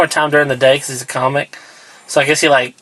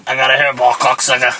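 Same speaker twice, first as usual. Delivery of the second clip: half-hearted low impression of a comedian; badly faked low comedian's voice; Joey Diaz; 4 semitones lower than usual. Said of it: "I can't do his voice".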